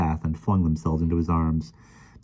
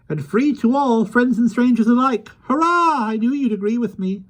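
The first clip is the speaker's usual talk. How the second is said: high, loud, delighted little man; excited; 4 dB louder than usual. Acted. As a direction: cheer as the plan takes shape. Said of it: "clapped his hands excitedly".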